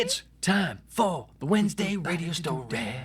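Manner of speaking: high, loud radio announcer; announcer hype. Pretend. Host announcing his radio story segment, hyped announcer. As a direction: sell it like a DJ promo.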